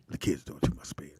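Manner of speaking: unvoiced hushed complaint; whispered. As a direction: hush it like a secret. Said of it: "He said".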